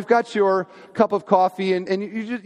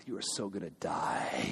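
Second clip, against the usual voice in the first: much softer, low threatening mutter; low, soft menace; 8 semitones lower, 15 dB quieter than usual. Retold or imitated. Homeowner to the dandelion, low soft menace. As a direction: lower and softer, promise doom quietly. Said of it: "you're like".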